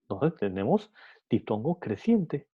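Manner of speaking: usual speaking voice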